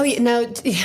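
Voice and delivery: normal talking voice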